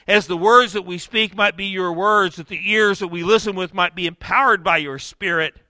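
earnest pastoral prayer. Acted. Pastor prays over the congregation before the sermon. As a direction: pray it for the congregation.

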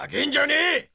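angry yell. Acted. A thug yells angrily at the woman. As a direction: snap at her furiously.

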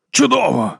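high-pitched exclamation; exclaiming. A narrator's reading. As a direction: exclaim it with a falling swoop. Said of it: "вигукнув Воткінс".

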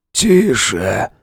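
drawn-out feeble croak; weak wheeze. Acted. Wounded man hushes his friend, wheezing. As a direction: hush him with little breath.

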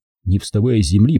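usual speaking voice

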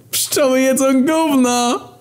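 shrill cartoonish squeak, drawn out slowly; very high squeaky voice. Shocking thread title read out. (bardzo wysoki, piskliwy głos)

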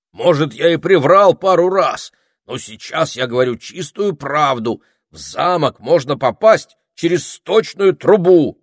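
high, loud, insistent self-defence; worked-up, high protest. A narrator's reading. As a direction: plead your honesty emphatically. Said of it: "запротестовал Этельред".

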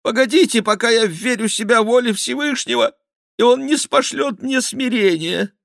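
high, pleading voice; anxious pleading. A narrator's reading. The cornered king begging for time, high and anxious. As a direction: stall them, trembling.

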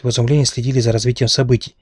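ordinary delivery, nothing marked

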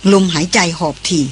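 ordinary delivery

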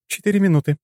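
high, level female announcement; monotone. A narrator's reading. Female companion counting down over communicator, monotone. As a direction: announce it on one high, even note. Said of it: "сообщила Лора монотонным голосом".

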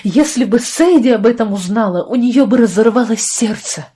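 loud, impassioned accusation; angry outburst. Acted. Лия jumps up to accuse the doctor, angry outburst.

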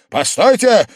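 loud, high call to stop; urgent shout. A narrator's reading. Host coming to, stopping his guest, shouting. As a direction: call him back sharply. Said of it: "закричал опомнившийся Вельчининов".

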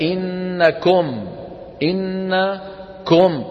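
إنكم with long hummed n and final "-kom"; impure damma. Retold or imitated. Teacher mimics a wrong reading, damma turned to oh. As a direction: chant إنكم slowly, oh in place of u. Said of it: "هذا الصوت هذا أو".

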